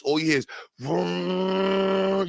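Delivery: drawn-out mock engine vroom; slow the vroom like a revving car. Describing reckless drivers, a slow mock engine vroom.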